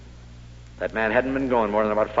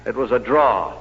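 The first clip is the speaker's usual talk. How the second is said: ordinary delivery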